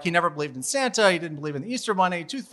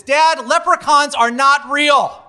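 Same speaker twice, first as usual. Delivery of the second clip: loud, high, slow mock indignant teen; an angry teenager slamming down the truth, loud and slow; angry son; 9 semitones higher, 7 dB louder, slower than usual. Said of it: "I can see he's mad".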